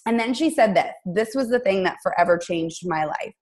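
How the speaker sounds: normal talking voice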